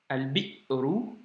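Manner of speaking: slow al-bi'ru, cut short after "bi"; drawn-out demo of "Al-bi'ru" with a catch after "bi"; hamza blocked. Shown as a demonstration. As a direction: say "Al-bi'ru" syllable by syllable, stopping hard on the hamza.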